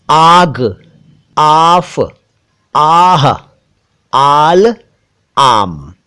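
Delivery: आग, आफ, आह, आल, आम each set apart by pauses; word drill. Shown as a demonstration. Teacher reading a word list aloud for viewers. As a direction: clear separate word-by-word reading. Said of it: "कुछ और शब्द देखिए".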